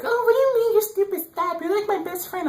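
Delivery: very high mock girl voice, teasing; high-pitched impression of a girl brushing it off; girly voice. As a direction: squeal it like a flustered teen girl. Girl's voice in retold chat.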